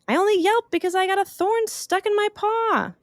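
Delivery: very high little bunny; a hurt bunny explaining. Acted. Bunny explaining its yelp to Opal, very high. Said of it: "The bunny said".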